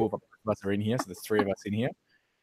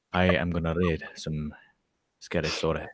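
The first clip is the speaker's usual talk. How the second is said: a lightly altered, lower storyteller voice; a hint of a spooky story reader; Mister Ramsey voice; 4 semitones lower than usual. [name] voices his alter ego introducing scary stories, lower. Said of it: "Mister Ramsey is gonna be reading you some scary stories".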